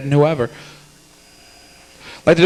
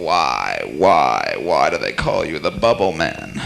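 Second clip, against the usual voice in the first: much lower, mock-puzzled heckler; mock audience voice; 11 semitones lower, slower than usual. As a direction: ham up a low dopey audience voice, second why shouted. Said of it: "I know by now you're saying".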